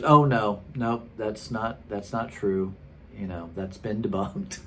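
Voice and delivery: matter-of-fact. Retold or imitated. Man dismissing DNA evidence to a doubter, matter-of-fact. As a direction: wave it away as settled fact. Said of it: "he just said it so matter-of-factly".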